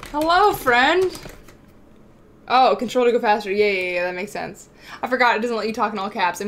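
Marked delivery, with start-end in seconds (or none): 0.1-1.2 sing-song greeting. Real, not acted. Greeting in-game friend.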